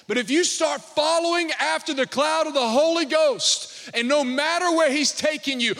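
loud, high preaching; preaching at full force. Acted. Pastor exhorting the congregation to follow God, full force. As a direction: drive it home to the church.

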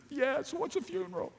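put-on high, soft child's voice; little daughter. Daughter asking dad about the funeral, high soft child voice. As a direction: sweetly ask like a small girl.

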